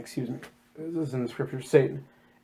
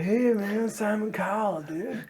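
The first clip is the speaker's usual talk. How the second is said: lightly voiced audition dude, higher and soft; jam-band dude; 4 semitones higher, 6 dB quieter, slower than usual. Contestant greeting Simon Cowell at an audition, lightly voiced dude. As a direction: play a mellow contestant greeting the judge. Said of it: "Three guys who are like".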